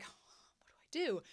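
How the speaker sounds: breathless whispered panic; panicked whisper. Retold or imitated. Shin Ching-cho at a loss, whispering to himself in panic. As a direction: whisper it like you're lost. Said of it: "Shin Ching-cho's like".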